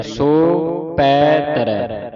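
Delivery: ਸੋ, ਪੈ, ਤਰੈ each drawn out on its own; separated slow words. Shown as a demonstration. Teacher modelling word division, slow separate ਸੋ ਪੈ ਤਰੈ. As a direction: slowly set ਸੋ apart from ਪੈ and ਤਰੈ. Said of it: "ਇਹ ਵੱਖਰੇ ਵੱਖਰੇ ਕਰਨੇ".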